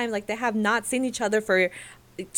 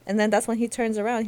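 normal talking voice

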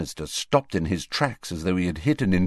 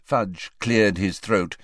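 plain reading voice

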